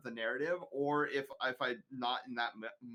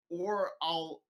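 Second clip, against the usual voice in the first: normal talking voice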